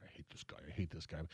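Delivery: hushed inner-voice whisper. Retold or imitated. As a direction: whisper it like a secret thought.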